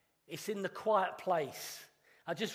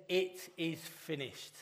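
dying last words; 2 semitones lower, 6 dB quieter, slower than usual. Retold or imitated. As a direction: say it slowly, softly, like a final breath. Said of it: "as he breathed his last".

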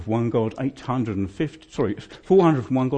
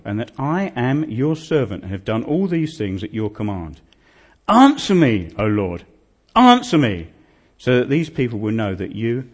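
scripture reading; 7 dB louder than usual. Acted. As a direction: proclaim the prayer as Elijah calling on God. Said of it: "And I want to read this bit".

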